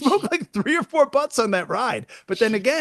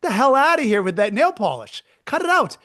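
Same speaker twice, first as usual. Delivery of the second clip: a hint of a tough conductor telling her off; 4 semitones higher than usual. Conductor scolding the nail polish woman, mock tough. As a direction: snap at her like a fed-up guard. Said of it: "be like".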